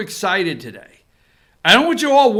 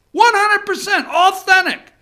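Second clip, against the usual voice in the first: mock candidate voice; 10 semitones higher, 4 dB louder than usual.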